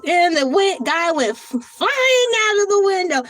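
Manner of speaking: high, loud, breathless shout; excited retelling. Retold or imitated. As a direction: relive the scene in a squeal. Retelling the film scene.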